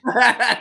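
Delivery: short, loud high laugh. Real, not acted. Co-host amused about the smoking, short laugh. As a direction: let out a quick loud laugh.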